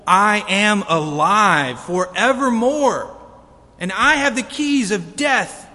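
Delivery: loud, ringing scripture reading; booming pulpit voice for the verse; full-force preaching. Acted. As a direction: proclaim it to the whole church. Reads Revelation at full force.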